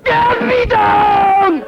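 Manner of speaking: high, loud, long-stretched yell; mock desperate scream. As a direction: scream it as if stuck up a tree. Imagined man hung on tree begs.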